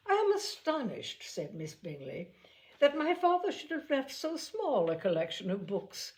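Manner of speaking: a lightly voiced flattering lady; affected Miss Bingley. A narrator's reading. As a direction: play up to Darcy.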